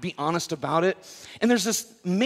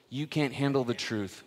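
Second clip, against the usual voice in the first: ordinary talk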